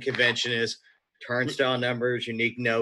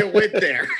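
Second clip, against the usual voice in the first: laughing; 8 semitones higher, 5 dB louder than usual. Real, not acted. Laughing at the bit.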